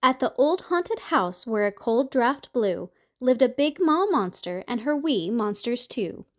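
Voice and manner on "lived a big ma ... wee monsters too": usual speaking voice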